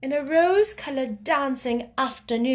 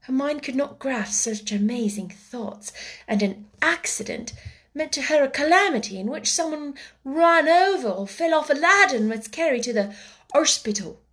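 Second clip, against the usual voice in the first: ordinary delivery, nothing marked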